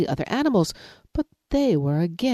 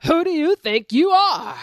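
angry lion; 11 semitones higher, 4 dB louder than usual. Acted. Lion shouts.

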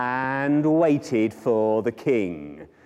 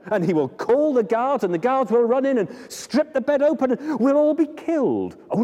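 anxious louse; 8 semitones higher than usual. Acted. Louse warns flea.

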